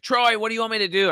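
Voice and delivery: pissed-off outburst, loud and high; retold scream. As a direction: cry out to a lost brother, held short of full scream. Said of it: "I screamed at the top of my lungs".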